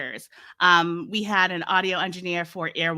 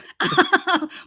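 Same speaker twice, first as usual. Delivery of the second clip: laugh; 10 semitones higher, 5 dB louder than usual. Real, not acted.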